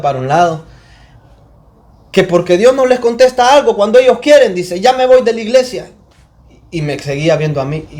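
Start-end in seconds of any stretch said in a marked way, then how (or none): 2.1-4.5 imitated pulpit rebuke, loud and high; forceful Honduran preacher. Pastor mocking those who demand answers from God, loud imitated preaching. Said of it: "eso tenía este pastor que le digo". 4.8-5.9 high-pitched mocking pulpit rebuke, imitated; forceful Honduran preacher. Pastor quoting a sulking churchgoer back at him, raised mocking preaching. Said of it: "eso tenía este pastor que le digo".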